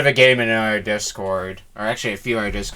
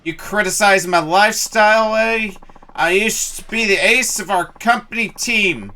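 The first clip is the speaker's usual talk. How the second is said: put-on higher, offended drunk ranting at the player; drunken indignation; 10 semitones higher than usual. Drunk fan rants at the player about baseball, higher and offended. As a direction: bristle like an insulted old ballplayer.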